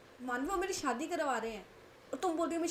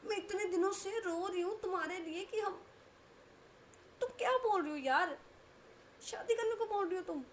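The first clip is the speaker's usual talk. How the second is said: high, shrill-free indignant outburst, much higher; outraged disbelief; 8 semitones higher than usual. Acted. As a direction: protest in shock and hurt. Kanak outraged at Manvi's advice.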